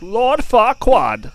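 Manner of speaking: high, slow, loud cartoon European accent; exaggerated piggy accent, high and stretched; Shrek pig accent. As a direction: draw it out in a mock foreign accent. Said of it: "it's like those pigs in, like, Shrek".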